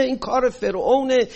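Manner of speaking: usual speaking voice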